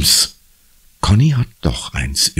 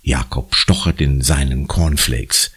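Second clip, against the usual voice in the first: ordinary delivery, nothing marked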